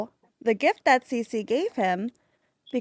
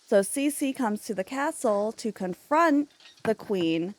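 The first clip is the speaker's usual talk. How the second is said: normal talking voice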